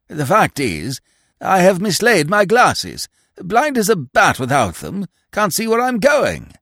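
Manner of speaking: put-on high, fluttery elderly toff; absent-minded old earl. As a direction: dither like a vague old lord. Vague earl apologizes.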